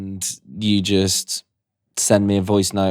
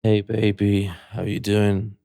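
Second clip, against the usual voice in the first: sexy voice note; 5 dB quieter than usual. Pretend. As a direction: play a tired smooth charmer. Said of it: "Can I do mine?".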